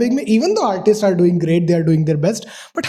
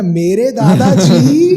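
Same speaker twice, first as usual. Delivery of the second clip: loud, drawn-out comic delivery; Samay Raina's tone; 6 dB louder, much slower than usual. Retold or imitated. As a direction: stretch it out loudly like a stand-up punchline. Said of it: "tone ऐसा ना". (Comedian की नकल, ज़ोर से खींचकर)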